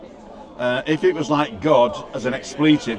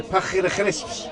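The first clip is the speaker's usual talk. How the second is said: higher-pitched Scouse impression with throaty k sounds; Scouse accent; 4 semitones higher than usual. Shown as a demonstration. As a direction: put on a thick Scouse accent. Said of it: "how does a Scouser talk again".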